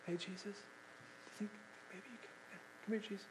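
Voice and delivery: hushed, barely voiced ask; timid whisper. Timid woman whispering to Jesus, not yelling. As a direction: whisper it meekly, no push. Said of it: "No. She is yelling".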